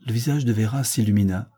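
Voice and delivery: plain reading voice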